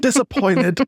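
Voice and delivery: toned-down mock cry to the heavens; mock yell, milder. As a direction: echo the melodramatic cry, half-hearted. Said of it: "he just yells at the heavens".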